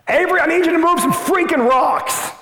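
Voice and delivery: sudden high, loud frustrated yell; lost temper, shouting. Retold or imitated. Dad yelling at his young daughter in the garden, angry shout. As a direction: snap after the patience runs out. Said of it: "Raised my voice".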